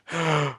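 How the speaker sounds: high surprised gasp; quick startled intake of breath; sharp gasp. Real, not acted. Sudden gasp.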